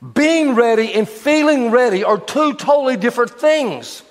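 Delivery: rally the church to take a risk; fervent preaching. Acted. Pastor urging the church to trust God, fervent preaching.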